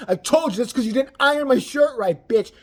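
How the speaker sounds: hammed-up furious husband; mock angry yell. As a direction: snap at her like a brute. Imagined abusive boyfriend yell.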